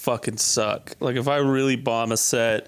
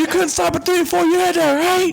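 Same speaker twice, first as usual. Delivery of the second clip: put-on much higher, louder drunken-angry film actor; DiCaprio scene impression; 6 dB louder than usual. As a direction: snarl the line at yourself in the mirror. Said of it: "my favorite scene of any movie".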